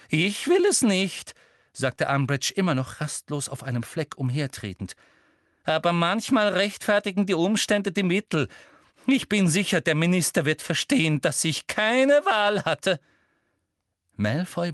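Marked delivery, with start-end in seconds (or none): 0.1-1.3 hoch, laut und gedehnt; aufgebracht. A narrator's reading. Umbridge rastlos, steigert sich hinein. 5.6-13.0 hohe, laute Selbstrechtfertigung; sich hineinsteigernd. A narrator's reading. Umbridge steigert sich hinein.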